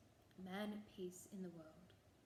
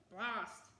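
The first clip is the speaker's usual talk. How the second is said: louder, fed-up curse; frustrated shout; 5 dB louder than usual. A narrator's reading. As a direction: bark it out in frustration. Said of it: "shouted the boggart in frustration".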